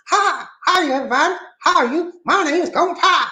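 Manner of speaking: high, cartoonish character greeting; my silly Gong Pao character voice. Shown as a demonstration. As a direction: pitch way up and play the character. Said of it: "Gong Pao voice".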